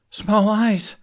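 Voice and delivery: yelp. A narrator's reading. Fishlegs answering Hiccup in panic, nervous yelp. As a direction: blurt it in fright. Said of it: "Fishlegs yelped".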